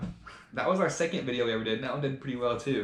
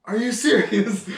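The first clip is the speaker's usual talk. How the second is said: much higher and louder, slow, freaking out; panicked disbelief; 10 semitones higher, 10 dB louder, slower than usual. Retold or imitated. Will panicking in the kayak, much higher and louder alarm. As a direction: shriek it like you're about to sink. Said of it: "And starts freaking out".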